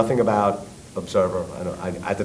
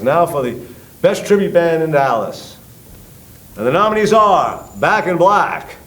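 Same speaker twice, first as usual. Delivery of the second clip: mock award-show host, pitched up; awards announcer; 7 semitones higher, 5 dB louder than usual. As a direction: announce the nominees to the whole room. Emcee announcing award nominees.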